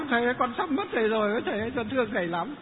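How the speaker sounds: mock wail, rushing at the end; retold crying. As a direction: act out a woman's plaintive cry. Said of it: "Bà cứ thế là khóc".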